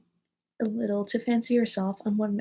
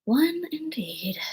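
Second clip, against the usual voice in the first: high, drawn-out whispery huff; indignant; 8 semitones higher, much slower than usual. A narrator's reading. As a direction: echo it slowly in offended disbelief. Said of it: "said the Dormouse indignantly".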